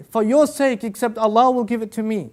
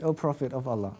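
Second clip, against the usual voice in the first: soft, low plea; 7 semitones lower, 7 dB quieter than usual. Retold or imitated. Sawad pleads with the Prophet.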